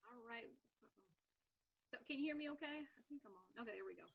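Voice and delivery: distant, faint voice off mic; speaking too far from the microphone. Real, not acted. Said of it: "Sound really far away".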